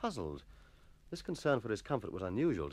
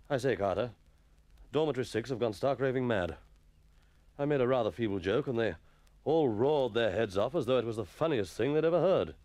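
schoolmaster voice; 3 dB louder than usual. Acted. Master confiding.

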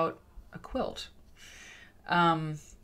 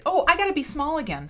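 surprised; 11 semitones higher, 7 dB louder, faster than usual. Real, not acted. Self-reminder mid-recording.

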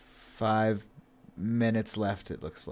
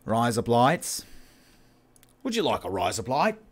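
Australian accent. Shown as a demonstration. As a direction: stretch the vowels into broad Australian. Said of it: "in an Australian accent".

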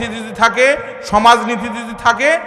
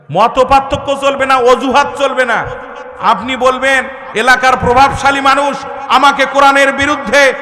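raised, insistent sermon building to a shout; full-force preaching; 6 semitones higher, 5 dB louder than usual. Acted. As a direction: drive each point home in rhythmic lists. Preacher builds up fiery argument.

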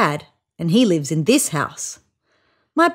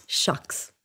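shy whispered aside, much softer; bashful whisper; 5 semitones lower, 10 dB quieter than usual. A narrator's reading. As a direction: whisper it, embarrassed and pleased. Bashful after mum's love.

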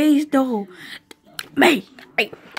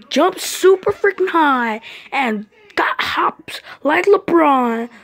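hype announcer; 3 dB louder than usual. Acted. Ring announcer hyping the champion, loud rising hype. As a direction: sell the champ to the crowd.

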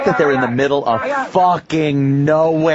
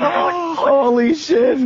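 excited; 13 semitones higher, much slower than usual. Real, not acted. Reacting to intense scene.

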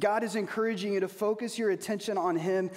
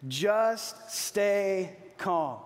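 very slow, drawn out, leading the crowd; slow call to repeat; much slower than usual. Shown as a demonstration. Preacher leading the congregation to repeat, very slow. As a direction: stretch every word so they can follow. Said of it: "You ready?".

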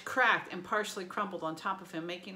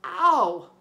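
a short pained ow, louder than a mutter; a surprised yelp of pain; startled monster ow; mild ow; 14 dB louder than usual. A narrator's reading. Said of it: "He muttered".

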